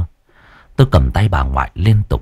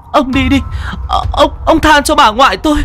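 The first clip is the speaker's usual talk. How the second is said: desperate shout. Acted. Grandchild shouting at the demon to spare grandmother. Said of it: "tôi hét lên".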